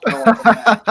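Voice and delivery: gushing through laughter about staying; laughing excited wife. Retold or imitated. Wife calling from Brazil, laughing and excited about staying. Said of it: "and she's like".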